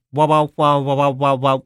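high, loud garbled wow-wow noise; imitating garbled speech. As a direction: mimic speech heard as pure noise. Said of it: "That was speech".

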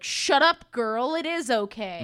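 loud sassy brush-off, shouted; mocking shout. Campers shouting down Annabeth, mocking and loud. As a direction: snap back at her like a mean girl. Camp kids snapping at Annabeth.

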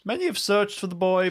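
high voice at normal volume; high-pitched, mysterious old-timer; portentous old eccentric. Acted. Old hermit answering the searchers, high portentous voice.

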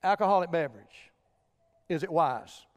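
low, soft, grave question; quiet, low-pitched pulpit gravity; solemn hush. Real, not acted. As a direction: drop right down and let it sink in. Preacher drops to solemn question.

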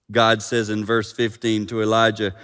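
ordinary delivery

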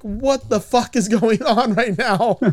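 drawn out, baffled; a touch of slow bewilderment; slow confused quote. Retold or imitated. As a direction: ask it slowly, lost. Confused woman, retold quote.

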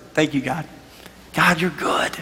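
low prayer fading to near whisper; hushed gratitude. Retold or imitated. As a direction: thank God under your breath. Retells his grateful prayer.

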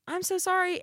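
very high, placating server voice; meek apology. Retold or imitated. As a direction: imitate a helpless apology in a high pitch. Retold apology to angry customer.

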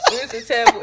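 laughing. Real, not acted. Naming the strip club again, laughing. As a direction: laugh through the club name.

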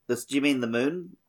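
ordinary talk

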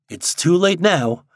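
a touch of angry resignation; mildly angry. A narrator's reading. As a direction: weigh each word in understated annoyance. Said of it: "thought Steve angrily".

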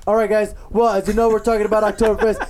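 show host intro. Acted. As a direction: kick off the segment like a show host. Host opens the segment.

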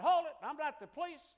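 high, loud call to stop. Retold or imitated. Preacher to scattering teenagers, loud high call. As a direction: shout them to a halt.

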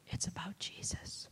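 very soft, whispered answer; small, breathy, reverent voice; hushed reply. Retold or imitated. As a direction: whisper it as the tearful passenger. Acts out weeping lady's answer.

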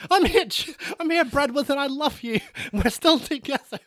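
high, clingy ghostly calling; ghost wife voice. Acted. Dead wife's ghost calling to Bradworth, high and clingy. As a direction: call out like a wife haunting her husband.

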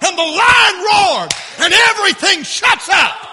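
loud, high shouted preaching; full-force preaching. Acted. Preacher telling how everyone falls silent when God speaks, shouting. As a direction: build to a roaring shout on the big phrases.

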